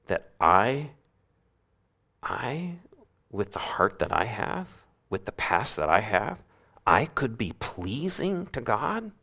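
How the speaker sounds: barely believing it yourself; awed disbelief. Pretend. Voicing a doubting believer.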